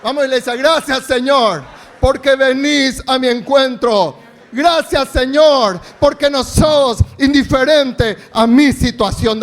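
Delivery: loud fervent praise shouts; preaching at full force. Real, not acted. Pastor stirring congregation into loud shouted praise. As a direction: drive the church into loud thanks. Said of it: "Pero digan bien fuerte".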